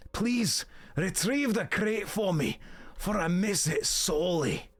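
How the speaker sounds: amateur Scottish, half pirate; Scottish accent. Acted. Quest-giver asking for the lost crate back, Scottish accent. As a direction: plead your quest in a put-on Scottish brogue. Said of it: "I've not done Scottish in a while".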